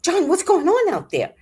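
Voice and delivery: high, alarmed call to John; the speaker calls to John outside, high and alarmed. Retold or imitated. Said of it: "And I said".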